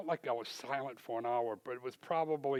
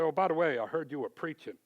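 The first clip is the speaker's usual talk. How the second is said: higher, louder gotcha tone; retold boss sneering, raised voice; mock pointed remark; 4 semitones higher, 7 dB louder than usual. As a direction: needle him about the preaching. Owner brings up preaching.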